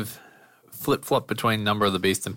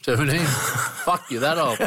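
surprised laughing disbelief; 6 semitones higher than usual. Real, not acted. Disbelief at friend's age.

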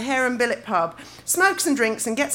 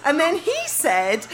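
ordinary talk, nothing marked

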